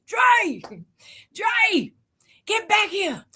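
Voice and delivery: high, loud call; yelling for him. Retold or imitated. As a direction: holler down the block for the boy. Dad yelling for son again.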